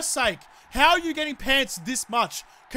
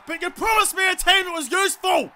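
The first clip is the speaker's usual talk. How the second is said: raised, higher-pitched scolding; angry complaint; 6 semitones higher, 4 dB louder than usual. Real, not acted. Player berating the team he signed for, angry raised voice. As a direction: let the grievance burst out.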